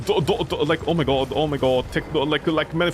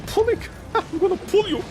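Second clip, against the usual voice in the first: high squeaky voice with a laugh; silly teasing; 13 semitones higher than usual. Acted. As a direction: toss it up high, joking around. Joking about the word pulling.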